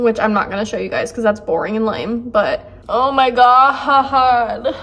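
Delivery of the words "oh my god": slow, loud wail broken by laughter; real emotional release, laughing through it; overwhelmed laugh-cry. Real, not acted. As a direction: stretch it out with laughing sobs. Overwhelmed after finishing the book.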